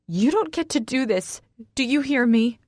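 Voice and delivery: high, heated confrontation; angry outburst. A narrator's reading. Woman shouting in a lovers' quarrel.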